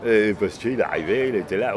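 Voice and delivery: ordinary talk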